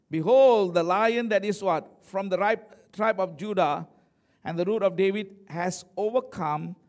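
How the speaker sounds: loud, emphatic scripture declamation; preaching proclamation. Acted. Preacher declares elder's news of the lion, loud and emphatic. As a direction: proclaim it to the congregation.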